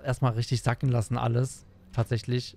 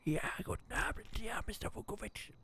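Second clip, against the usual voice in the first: real whisper, soft and quick; 6 semitones higher, 15 dB quieter, faster than usual. Real, not acted. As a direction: whisper to keep the babies asleep. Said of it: "ich werde ein bisschen leiser reden".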